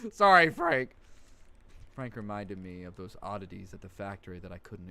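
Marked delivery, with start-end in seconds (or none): 0.1-0.9 loud, higher blurted apology; loud sheepish shout; shouted sorry. Real, not acted. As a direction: yell the sorry out. Blurted apology after cursing Frank.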